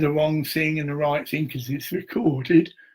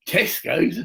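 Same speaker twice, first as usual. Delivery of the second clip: usual speaking voice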